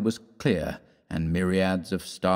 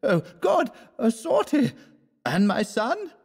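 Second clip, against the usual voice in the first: much higher, fearful cry for a son; anxious cry. Acted. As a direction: cry out in worry. Anxious mother in crowd.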